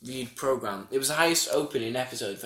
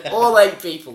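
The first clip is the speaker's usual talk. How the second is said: louder, higher exclamation; mock outrage; 7 semitones higher, 14 dB louder than usual. Real, not acted. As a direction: exclaim it loudly in mock dismay. Joking exclamation.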